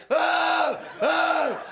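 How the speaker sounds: high, long shouted jeer; loud drawn-out mock booing; imitated crowd boo. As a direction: howl like a hostile audience. Imitates crowd booing his opening.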